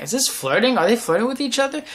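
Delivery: high, rising incredulous question; mock giddy suspicion; retold fan excitement. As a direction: wonder aloud like an intrigued fan. Fan reacting to flirty scene.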